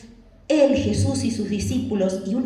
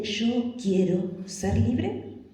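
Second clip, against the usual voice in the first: very slow, word by word; deliberate self-declaration; 4 dB quieter, much slower than usual. Shown as a demonstration. Telling yourself you want to be free, slow deliberate resolve. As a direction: say it slowly, weighing every word. Models what to tell oneself.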